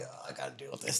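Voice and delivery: unvoiced weary whisper; under-breath grumble; whispered annoyance. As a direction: breathe it out like a tired bouncer. Muttered annoyance at club.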